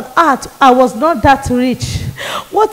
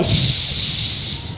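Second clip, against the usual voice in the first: long hushed frying hiss; sizzling sound; 11 dB quieter than usual. Retold or imitated. Showing her body under the pressing iron, sizzling sound. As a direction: imitate fish sizzling in hot oil. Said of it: "will do like fish inside oil".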